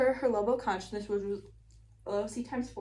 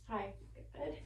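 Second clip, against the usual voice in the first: whisper; 9 dB quieter than usual. Shown as a demonstration. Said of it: "I'm gonna whisper in this side".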